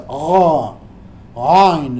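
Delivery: "Oh, ain" pushed from deep in the throat; guttural ain. Shown as a demonstration. Teacher demonstrating the guttural ain to students, throaty. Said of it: "es una palabra muy gutural".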